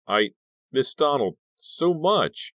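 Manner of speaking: tearful. A narrator's reading. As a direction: let the grief spill, slowly. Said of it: "couldn't keep them back".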